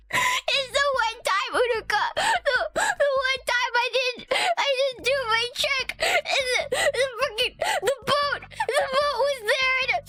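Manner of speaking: high, hammed-up weepy lament; beating oneself up. Driver blaming self to friend for hitting the boat, mock weepy. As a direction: wail in stuttering bursts, half-laughing. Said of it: "I was, like, beating myself up".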